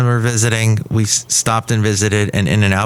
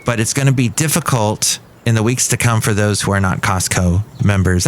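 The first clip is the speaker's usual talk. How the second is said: ordinary talk, nothing marked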